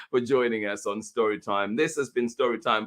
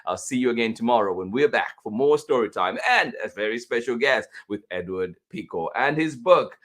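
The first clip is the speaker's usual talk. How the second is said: ordinary talk, nothing marked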